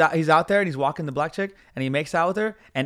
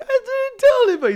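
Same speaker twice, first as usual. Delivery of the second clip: very high, loud imitated wail; hammed-up tearful squeal; mock crying; 7 dB louder than usual. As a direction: plead like the slapped guy in tears. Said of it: "He's crying and shit".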